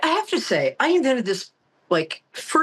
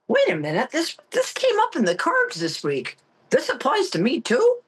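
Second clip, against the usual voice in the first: rising disbelief; 7 semitones higher than usual. Retold or imitated. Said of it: "I'm like".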